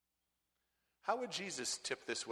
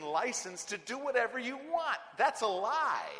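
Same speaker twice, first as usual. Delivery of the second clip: loud, high fiery preaching; preaching at full force; 6 semitones higher, 6 dB louder than usual. Acted. Preacher driving the point home to the congregation, loud and fiery. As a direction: thunder it from the pulpit.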